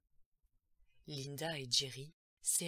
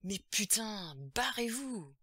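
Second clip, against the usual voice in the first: loud, raised-pitch angry yell; shouting; 5 semitones higher, 6 dB louder than usual. A narrator's reading. Possessed girl yells at her family, shouting. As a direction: yell it out to drive them away. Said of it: "elle se mit à crier".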